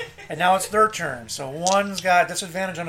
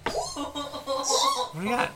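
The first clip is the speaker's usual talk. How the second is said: drawn-out high call; 11 semitones higher, 4 dB quieter, much slower than usual. Pretend. DM drawn-out playful prompt.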